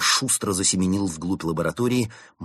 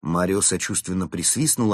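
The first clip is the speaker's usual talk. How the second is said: ordinary delivery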